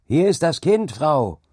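high, loud, urgent cry; shouted. A narrator's reading. John hands the mother her child, shouting. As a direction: shout it to the woman over the storm. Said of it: "schrie John ihr zu".

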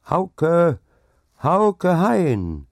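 high, loud hail; ringing shout to someone outside; loud cheerful call. A narrator's reading. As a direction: call the name joyfully out into the night. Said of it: "rief es laut und fröhlich".

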